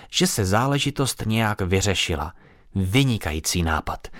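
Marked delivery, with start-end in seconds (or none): none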